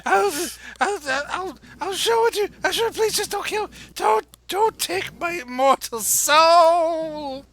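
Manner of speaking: high panicked pleading; terrified begging. Acted. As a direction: plead desperately, voice soaring. Captive begging for his soul.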